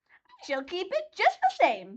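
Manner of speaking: high witch voice, mocking; mocking laugh. A narrator's reading. Witch taunting Dorothy, high and mocking. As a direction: taunt her while laughing. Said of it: "laughing at her".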